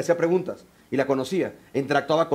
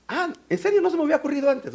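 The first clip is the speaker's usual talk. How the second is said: high-pitched mock revelation; sarcastic amazement; 9 semitones higher, faster than usual. Depressed person answering useless advice, sarcastic.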